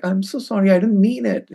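normal talking voice

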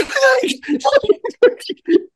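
shrieking laugh. Real, not acted. Big laugh.

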